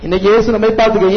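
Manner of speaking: ordinary talk, nothing marked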